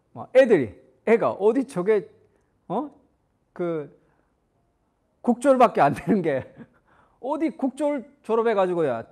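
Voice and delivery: loud, contemptuous put-down of the pastor; mock scolding. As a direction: sneer at him like a rude kid mouthing off. Insulting kids acted out. (무시하며 대드는 말투 흉내)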